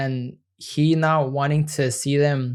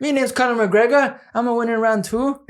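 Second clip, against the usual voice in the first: Irish accent; 8 semitones higher, 3 dB louder than usual. Shown as a demonstration. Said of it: "Can you do a Irish accent?".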